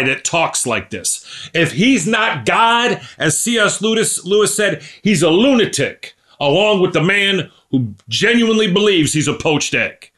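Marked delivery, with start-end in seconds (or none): 1.5-10.1 excited. Real, not acted. Excited quoting C S Lewis.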